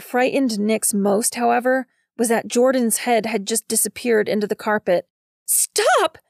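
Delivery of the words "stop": high-pitched cry to halt; shouting to stop someone in a panic; urgent shout. Acted. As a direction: shout it sharply, far above your usual pitch. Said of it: "Nix shouted at Belly".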